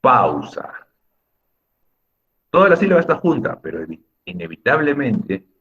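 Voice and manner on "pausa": PAAU-sa, leaning on the first A; stress on the A. Shown as a demonstration. Teacher shows the strong vowel, leaning on the A of "Pausa". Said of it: "la sílaba que pronuncias más fuerte".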